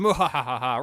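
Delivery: loud villain cackle; evil laugh. Pretend. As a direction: gloat like a cartoon villain. Evil laugh while grabbing apples.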